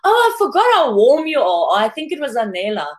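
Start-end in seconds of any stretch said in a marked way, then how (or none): 0.0-1.7 higher-pitched imitation of a delighted remark; mock pleased surprise, lightly imitated; quoted exclamation. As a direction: retell it as a high, mildly gushing exclamation. Quoting Tyson's pleased remark.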